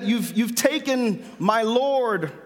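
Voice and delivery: normal talking voice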